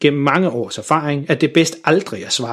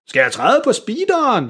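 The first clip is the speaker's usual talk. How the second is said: much higher, called over noise; shouting question; 9 semitones higher than usual. A narrator's reading. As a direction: call out over the engine. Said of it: "råber han". (faren råber spørgende over motoren)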